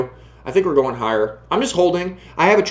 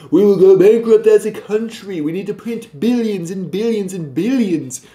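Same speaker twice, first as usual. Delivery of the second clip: Trump impression; 4 semitones higher than usual. Vlogger redoes his point as a mock president, blustery impression. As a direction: pitch it like a rally speech. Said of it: "I'll say it with my Trump voice".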